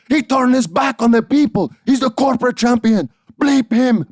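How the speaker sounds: put-on loud, very high ranting in Puerto Rican accent; heavy Puerto Rican accent, shrieked indignation; upset Puerto Rican grandma. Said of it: "she's really upset, and she has this heavy Puerto Rican accent".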